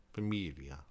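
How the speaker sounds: familia with ly, fa-MEEL-ya; Italian gli as ly; Italian gli sound. Shown as a demonstration. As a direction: repeat familia for students. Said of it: "similar to the double L in million".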